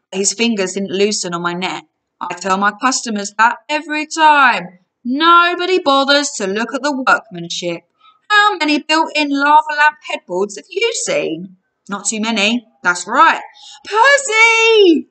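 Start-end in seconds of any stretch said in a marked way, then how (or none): 2.2-13.4 proud salesman, higher-pitched patter; giant salesman character. Acted. Giant brags to customer about his workmanship, proud patter. 13.9-15.1 high, loud yell. A narrator's reading. Annabeth calls friend from the stretching bed, yelling. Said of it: "Annabeth yelled".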